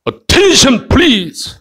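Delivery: high-pitched command to the troops; army general. Acted. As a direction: call troops to attention, mock-military. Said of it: "장군이".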